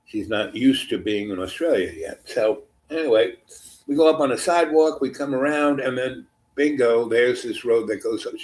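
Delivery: usual speaking voice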